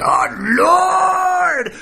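high, drawn-out cry to the Lord; hammed-up praying shout; fervent prayer. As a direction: wail it out like an over-fervent prayer. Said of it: "he was a fervent prayer".

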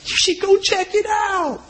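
high, slow, shouted dare; urging shout. Retold or imitated. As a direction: yell it up high to lure the brothers in. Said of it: "he goes".

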